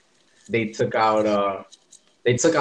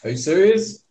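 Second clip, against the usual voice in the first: mock disbelief; 5 semitones higher than usual. Real, not acted. Teasing disbelief at friend.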